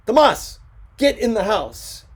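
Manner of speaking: loud, high command; stern order. Acted. Ricardo ordering his son inside, stern and loud. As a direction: order him in sharply.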